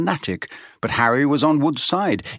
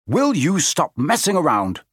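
raised, exasperated shout at the team; a captain losing patience; yelling; 6 semitones higher than usual. A narrator's reading. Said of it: "he yelled".

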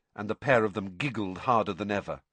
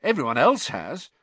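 higher-pitched, pressing complaint; impatient; 9 semitones higher, 4 dB louder than usual. A narrator's reading. Ron presses Hermione about needing partners, impatiently. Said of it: "he said impatiently".

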